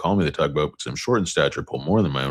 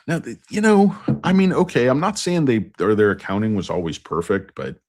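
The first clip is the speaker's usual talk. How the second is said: ordinary talk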